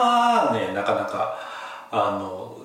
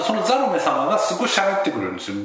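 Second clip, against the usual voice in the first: ordinary talk, nothing marked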